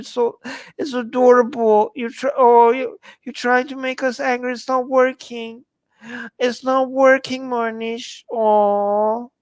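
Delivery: put-on high, drawn-out baby-talk cooing; mock pity. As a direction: coo with fake sympathy at a pitiful troll. Mock pity for a chat troll.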